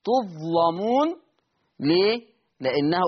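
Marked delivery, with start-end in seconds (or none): 0.0-1.1 تظلمون recited slowly with a thick, heavy lam; slow recitation of تظلمون with heavy lam. Shown as a demonstration. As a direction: say تظلمون with the lam darkened and full. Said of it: "تغليظ اللام".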